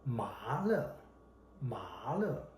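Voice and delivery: "麻了" with 麻 sweeping upward in pitch; rising second tone. Shown as a demonstration. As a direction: lift the pitch through 麻 in "麻了". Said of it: "Another tone is rising tone".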